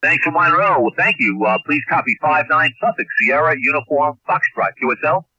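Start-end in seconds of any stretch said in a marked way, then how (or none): none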